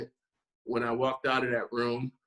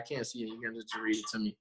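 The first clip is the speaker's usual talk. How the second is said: softer, half-asleep groggy mumble; sleepy mumble; 8 dB quieter, faster than usual. Retold or imitated. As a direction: mumble it as if just woken at three a.m. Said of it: "I was like".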